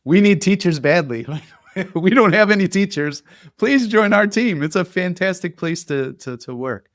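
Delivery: loud, high pleading voice of a desperate school ad; mock desperate job ad. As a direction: beg like a school that nobody will join. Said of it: "most of the posts just go like this".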